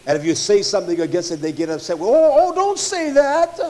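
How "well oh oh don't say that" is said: mock offended. Mocking upset churchgoers.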